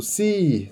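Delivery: "si" stretched slowly with a plain S; wrong reading. Shown as a demonstration. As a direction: draw out "si" the naive way. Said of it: "Isso aqui você leria como". ("si" lento, com S de sapo)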